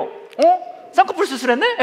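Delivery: high, loud act of tactless noticing; mock blurted surprise. Preacher acting out someone spotting eyelid surgery, mock surprise.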